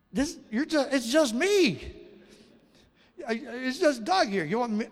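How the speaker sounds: high, loud, stammering protest; can't believe they picked him; overwhelmed, pointing at himself; flustered disbelief. Retold or imitated. Asked to reach a nation's students.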